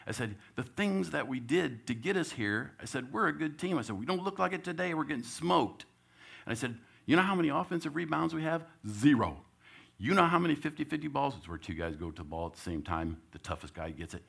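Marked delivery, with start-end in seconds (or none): 0.1-11.3 higher, louder fired-up coach; rally the team with urgency; coach firing up team. Retold or imitated. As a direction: coach chewing out a flat team in a huddle. Coach scolding team in huddle.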